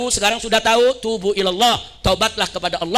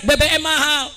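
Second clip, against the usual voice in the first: higher-pitched retold complaint; mock protest cry; 5 semitones higher than usual. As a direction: imitate a crowd's mild outcry. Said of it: "Mereka teriak". (seruan protes tiruan)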